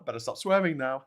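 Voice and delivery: ordinary talk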